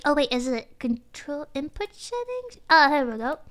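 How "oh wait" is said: ordinary talk, nothing marked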